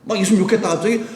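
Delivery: usual speaking voice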